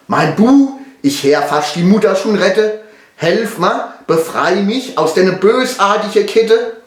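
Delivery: high-pitched, acted distress; pleading father. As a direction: beg your son to rescue you. Father begs son for help. (flehender Vater, gespielte Not)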